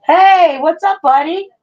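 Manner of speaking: grandma greeting her grandson. Real, not acted. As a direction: greet the little one in a high cooing voice. Grandma greets toddler arriving home.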